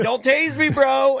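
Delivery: half-hearted imitation of yelling it at home; retold household yell. Husband jokingly yells household catchphrase at wife, mock yell. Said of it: "our number one yelled phrase".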